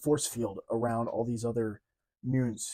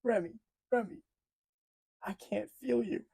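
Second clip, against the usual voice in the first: high, imitated tearful plea; a fan voicing Rogue's grief, mildly tearful; imitating crying Rogue; 10 semitones higher than usual. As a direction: mimic her calling him through tears. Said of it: "she's crying".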